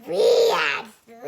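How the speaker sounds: scary voice. Pretend. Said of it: "Oh, a scary voice".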